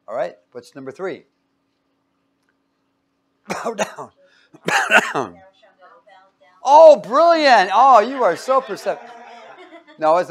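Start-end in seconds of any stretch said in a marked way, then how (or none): 3.4-5.4 words disguised as a loud, higher cough; fake cough. Teacher slipping the answer to students, fake cough. 6.6-9.1 loud, high gushing praise; mock admiration. Teacher teasing students over their answer, mock admiration.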